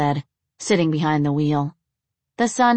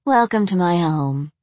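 usual speaking voice

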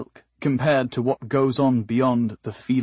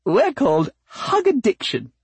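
high, louder, nervously bright flower voice; nervously sweet; 10 semitones higher, 5 dB louder than usual. A narrator's reading. Flower naming her group to a hungry cat, nervously sweet. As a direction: pitch it up with anxious sweetness. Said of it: "twisting her leaves nervously".

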